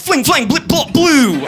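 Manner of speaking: high, silly ad-libbed gunshot sounds; ad-lib goofy gun noises like a rapper; mock gun noises. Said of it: "gun noises".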